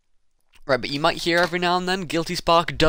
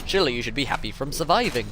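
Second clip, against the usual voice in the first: ordinary talk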